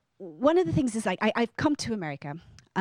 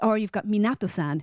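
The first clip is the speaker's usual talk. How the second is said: normal talking voice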